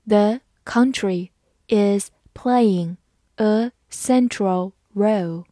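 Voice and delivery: word-by-word, with clear gaps between words; slow reading. Shown as a demonstration. English teacher reading to learners, slow and separated word by word. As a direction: read slowly for learners, one word at a time. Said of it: "好，慢慢来读".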